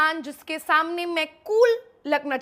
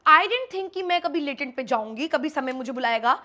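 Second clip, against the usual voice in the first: ordinary talk, nothing marked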